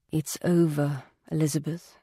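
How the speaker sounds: low, hushed reassurance; very soft. A narrator's reading. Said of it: "he said very softly".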